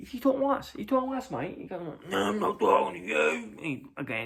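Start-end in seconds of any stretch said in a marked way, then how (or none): 0.1-1.6 high-pitched mock protest; acted squeaky-high reply; lads' retort. Acting lads answering shouting fan. 2.1-3.6 high, loud Aussie retort; hammed-up Australian fan; crazy Aussie accent. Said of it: "it was a bit crazy Aussie accent".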